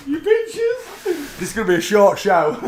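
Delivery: loud, high, laughing mock outrage; laughing at the long wait; laughing complaint. Real, not acted. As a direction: scold them while laughing. Laughing at the two-hour wait.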